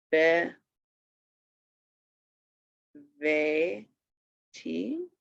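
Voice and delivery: "Be", "Ve", "Ti" with long gaps; separate syllables. Shown as a demonstration. Teacher showing each syllable alone, "Be", "Ve", "Ti" spaced out. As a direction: sound out "Be", "Ve", "Ti" one at a time. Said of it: "it is a syllable itself".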